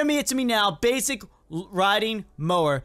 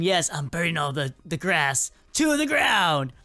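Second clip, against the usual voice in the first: ordinary delivery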